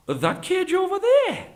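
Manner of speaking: very high-pitched incredulous question; feigned disbelief. Acted. Beggar doubting the tiger's story, incredulous.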